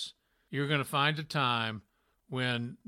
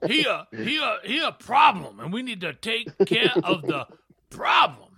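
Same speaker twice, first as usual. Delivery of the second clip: mock indignation; 4 semitones higher, 6 dB louder than usual. Mock outrage about boss.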